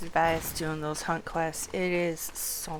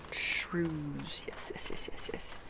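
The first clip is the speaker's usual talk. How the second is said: soft whispered glee over the find; gleeful whisper; 9 dB quieter, slower than usual. Real, not acted. Player spotting the shrews on the hunt, whispered glee. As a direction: hiss your delight under your breath. Spots target monsters.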